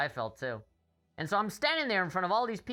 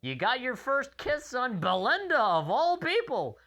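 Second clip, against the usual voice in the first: teasing dad; 6 semitones higher, 3 dB louder than usual. Retold or imitated. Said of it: "my dad was like".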